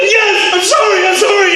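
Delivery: retold yelled apology; shouted apology. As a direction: blurt the apology. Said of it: "They go".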